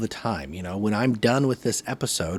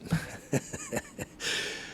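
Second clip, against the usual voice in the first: short laugh; 10 dB quieter than usual. Real, not acted. Joking that he's a natural.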